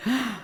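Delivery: a startled intake of breath; sudden shocked gasp; sharp gasp. Retold or imitated. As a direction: gasp as if caught off guard. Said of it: "this is what I did".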